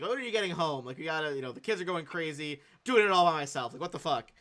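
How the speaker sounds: a hint of a fed-up wife on the phone; exasperated wife. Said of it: "sounds like his wife being like".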